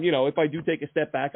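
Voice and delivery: usual speaking voice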